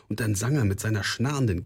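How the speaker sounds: plain reading voice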